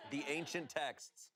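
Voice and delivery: slow and grand, laughing; mock epic. Joking about an old Reddit post, mock epic. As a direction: intone like a legend.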